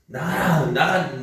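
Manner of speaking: put-on loud, high "get out" at a child; angry parent yelling. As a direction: throw them out in anger. 부모가 화내며 하는 말. (화난 부모의 고함)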